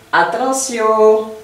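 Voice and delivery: ATTENTION slow, climbing to a held high end; rising tone. Shown as a demonstration. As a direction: stretch ATTENTION and lift its ending. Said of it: "toujours en remontant".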